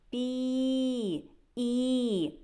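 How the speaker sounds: "Пі, і" with a long, steady I; "Пі, і" as a sustained teaching vowel; drawn-out vowel. Shown as a demonstration. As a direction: hold the і of "Пі" as if singing it. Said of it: "Ми можемо проспівати цей звук".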